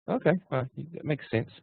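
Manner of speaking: high, soft frog voice; persuaded frog. Acted. Frog agreeing to carry the scorpion, high soft voice. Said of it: "And the frog goes".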